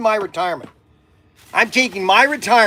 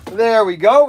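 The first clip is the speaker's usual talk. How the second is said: louder "There we go" with a rising end; louder cheerful "There we go"; 6 dB louder than usual. Real, not acted. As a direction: call it out, pleased.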